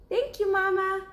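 high, small cub voice; little cub asking. Acted. Cub asking mother about sleeping outside, high little voice. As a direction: ask like a curious little bear cub. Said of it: "said Baby Bear".

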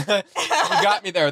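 slow laughing repeat. Real, not acted. Streamer repeating his quip to the chat, laughing. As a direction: laugh the words out.

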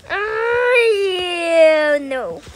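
loud wail falling to a no; drawn-out wail. Pretend. Seven loses in the game, wailing. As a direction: cry out, sliding down.

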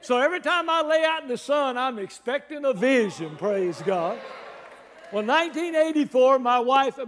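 big, punchy revival preacher voice; energetic preaching. Real, not acted. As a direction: drive the story home to the congregation. Preaches the rooftop story.

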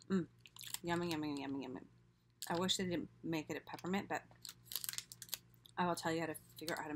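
savoring with closed mouth; chewing while talking. Real, not acted. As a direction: mouth full of food. Seen 1: bites snack at -2s, closed chewing mouth during mm sounds.

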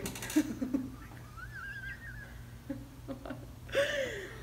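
high helpless laughing fit; burst of laughter. Real, not acted. Laughing hard as her friend yells for Ari. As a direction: crack up, almost in tears.

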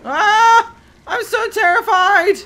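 hammed-up high, loud fake panic; a squeal of pretend terror; mock terrified. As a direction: play at being scared out of your wits. Mock fear of the fire.

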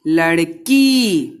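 लड़की said slowly, the की held long; slow model word. Shown as a demonstration. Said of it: "का पे बड़ी".

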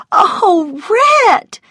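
very high, wailing exclamation; distressed cry. A narrator's reading. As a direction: cry out to him in dismay. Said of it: "she cried".

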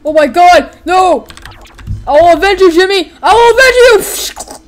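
loud, high cries over a fallen comrade; mock-heroic vow, building to a drawn-out yell; hammed-up battlefield grief and revenge; melodramatic vengeful shout. Voicing vow over fallen Jimmy.

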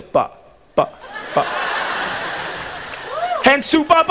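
usual speaking voice

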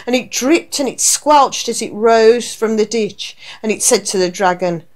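ordinary delivery, nothing marked

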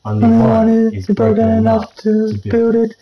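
high eerie alien voice. Pretend. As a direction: read it like a hive mind. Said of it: "Like an alien voice to a hive mind voice".

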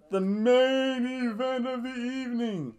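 high, slow, stretched-out announcement; grand, drawn-out mock announcer call; ring announcer. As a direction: announce it like a big fight. Announces main event like ring announcer.

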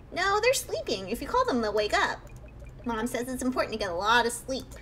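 put-on little kid voice. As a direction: play a cheeky ghost kid pretending to be normal. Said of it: "I'll just give it a little kid voice".

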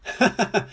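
high, staccato storybook laugh; acted chuckle. A narrator's reading. Roly amused by Bob's mistake about jam, acted high laugh. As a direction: laugh in short, high bursts. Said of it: "laughed Roly".

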